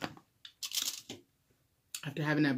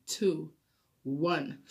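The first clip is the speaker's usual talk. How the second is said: usual speaking voice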